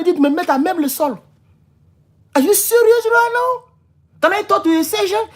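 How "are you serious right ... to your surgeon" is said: acted disbelieving demand. Driver asking for the sergeant, incredulous. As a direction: challenge the officer in replayed disbelief.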